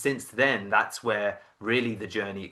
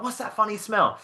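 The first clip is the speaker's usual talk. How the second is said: much higher-pitched questioning voice; imitating mum; 10 semitones higher than usual. Mum smelling smoke in the garden, mock puzzled. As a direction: put on a puzzled mother's high voice. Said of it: "My mum would be like".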